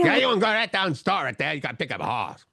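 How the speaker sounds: hard-to-understand mock Cajun, starting high; run-together Southern Cajun imitation; thick Cajun accent. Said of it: "the way I've heard people do it".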